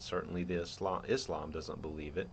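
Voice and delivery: normal talking voice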